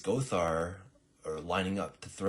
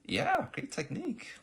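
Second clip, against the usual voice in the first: sarcastic squeak; 3 dB louder than usual. Acted. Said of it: "And Shadowheart goes".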